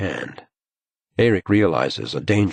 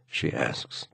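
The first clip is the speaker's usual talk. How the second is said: ordinary delivery, nothing marked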